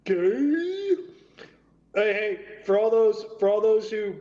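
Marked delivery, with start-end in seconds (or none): 0.0-1.0 mock disbelief.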